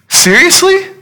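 very high-pitched disbelieving question; incredulous. Acted. Preacher as the stunned parent questioning Paul, incredulous. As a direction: squeak it out in disbelief.

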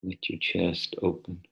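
soft, unhurried meditation voice; soft spa whisper. Acted. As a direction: ease them gently into stillness. Leads a guided meditation.

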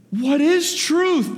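milk the question like a stage philosopher, drawn out and high; grand dramatic reading. Shown as a demonstration. Preacher mocking how some people read Pilate's line, hammed-up and slow. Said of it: "some people like him to say".

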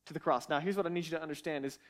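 ordinary talk

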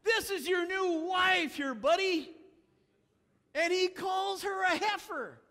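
loud mock-outraged rebuke of the groom; indignant shout. Real, not acted. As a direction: call him out, incredulous. Preacher scolding Samson.

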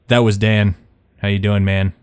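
usual speaking voice

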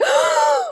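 sharp, high mock gasp; offended gasp. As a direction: gasp in put-on outrage. Imitating her offended reaction.

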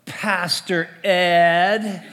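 loud, drawn-out teasing call laughing at the end; playful shout across the court. Retold or imitated. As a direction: call out the name slowly with a laugh. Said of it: "she goes".

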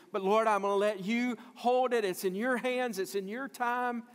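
impassioned cry to the Lord; fervent plea. Acted. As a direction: pray it out emphatically, pitched well up. Acted prayer handing it over to God.

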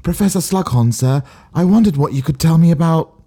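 a hint of prim English schoolgirl; lightly voiced English pupil asking sir; posh English accent. To Professor Slughorn, in a light English schoolgirl accent. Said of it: "most accurate English accent".

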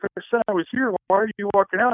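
high exasperated retold question; pleading in mock panic; flustered protest. Protesting to bride.

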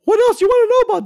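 very high cartoon mouse falsetto; [name]. New theme park correspondent answering the host's call, cartoon mouse falsetto.

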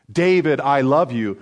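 normal talking voice

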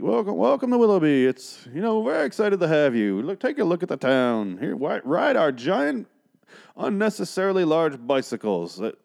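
oversell the town like a pushy host; mock town greeter. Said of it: "I don't know why they sound like Bane".

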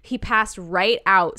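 ordinary delivery

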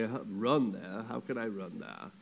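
joking low-voiced imitation of a baffled official; mock vice president. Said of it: "That's not how our vice president sounds".

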